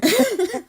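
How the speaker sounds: high bright laugh; burst of laughter. Real, not acted. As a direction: laugh out loud at the joke. Teasing the radio voice.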